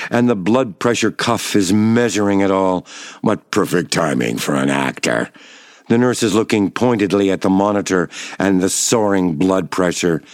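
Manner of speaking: ordinary delivery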